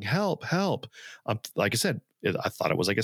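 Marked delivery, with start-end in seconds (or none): 0.0-0.9 mild mock cry for help, higher; retold yelling. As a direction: call out help lightly, pitched up. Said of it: "just starts yelling".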